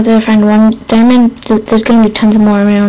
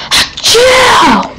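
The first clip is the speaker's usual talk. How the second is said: huge mock sneeze, pitched way up; elephant-like sneeze; 13 semitones higher than usual. Gamer imitating a YouTuber's elephant sneezes, huge mock sneeze. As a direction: trumpet out a fake sneeze. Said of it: "his sneezes are like elephants".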